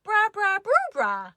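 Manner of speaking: put-on high, loud baby babbling; baby sister copying the others in babble. A narrator's reading. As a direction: babble like a baby trying to talk. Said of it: "because she's a baby".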